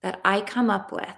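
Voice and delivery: tapping script. Acted. Coach leading a tapping round, calm guided voice. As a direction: lead the group through the affirmations calmly.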